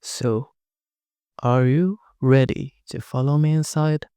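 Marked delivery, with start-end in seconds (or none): none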